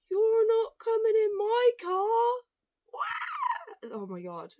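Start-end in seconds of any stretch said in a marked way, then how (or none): 0.1-2.4 put-on much higher, louder, slow childish bossy taunt; mock bossy supervisor. Mocking bullying supervisor. 2.9-3.9 shrieked high fake baby cry; mock toddler wail. Mocking supervisor as toddler.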